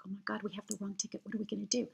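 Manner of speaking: fast, breathless whisper; panicked whisper. Retold or imitated. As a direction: hiss it so the inspector won't hear. Panicking about the ticket inspector.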